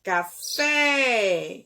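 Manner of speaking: "café" with a drawn-out, emphatic "fé"; calling out the word. Shown as a demonstration. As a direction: stress on FÉ in "café", stretched long like calling someone. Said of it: "você vai chamar a palavra".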